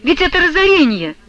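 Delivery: high-pitched cry of dismay; mildly sobbing. A narrator's reading. Maria laments their ruin to Alexei, mildly sobbing. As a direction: lament it high, a touch of a sob. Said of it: "всхлипнула Марья Гавриловна".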